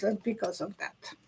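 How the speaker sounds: usual speaking voice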